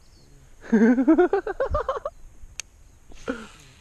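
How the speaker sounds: loud excited laughter. Real, not acted. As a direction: burst out laughing high. Laughs at the carp.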